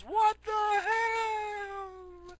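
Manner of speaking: very high, long drawn-out squeal; dying wail. Retold or imitated. Whole city's last words as they die, mock dying wail. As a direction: stretch it into a slow falsetto death cry.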